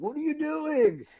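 high, slow, disbelieving cry; watching a friend burn money, aghast and drawn out. Retold or imitated. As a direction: stretch it out in horror. Watching cards destroyed, aghast.

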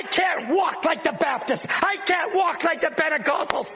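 fervent pulpit cry building on each line; preaching. Acted. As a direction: preach it out to the congregation. Preaching at full force.

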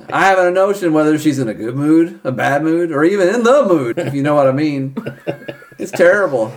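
mock-solemn confession building into comic despair; apologetic father, then exasperated.

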